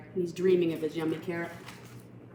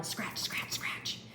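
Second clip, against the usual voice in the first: breathy whispered scratching; 8 dB quieter, slower than usual. A narrator's reading. Narrator voicing bunnies digging, whispered.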